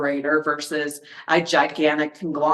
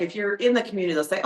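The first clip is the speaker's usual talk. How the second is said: normal talking voice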